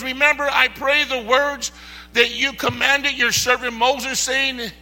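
shouted revival preaching; full-force preaching. Acted. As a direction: preach it hard to the congregation.

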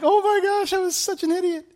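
high, exasperated cry at her younger self; self-mocking gasp. Reading her old journal, high disbelieving gasp. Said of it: "I was like".

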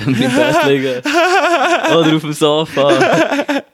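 laughing through an apology. Real, not acted. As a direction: giggle while saying it.

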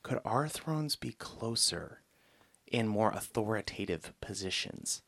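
quietly sneak in the big ask, imitating; sneaky request. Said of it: "kinda sneak up to Jesus and say".

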